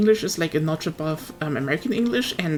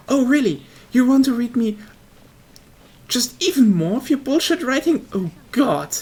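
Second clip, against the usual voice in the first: exasperated reader; 4 semitones higher, 4 dB louder than usual. Retold or imitated. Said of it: "it's like".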